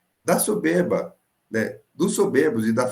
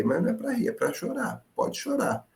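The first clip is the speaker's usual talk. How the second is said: impassioned high plea; 13 semitones higher, 5 dB quieter than usual. Real, not acted. Preacher presses his point.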